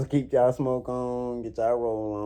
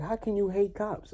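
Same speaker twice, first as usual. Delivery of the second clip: high, mocking imitation of a critic; mocking quote; 12 semitones higher than usual. Mocking people who question him, high mimicry. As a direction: parrot them in a pitched-up voice. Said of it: "you like".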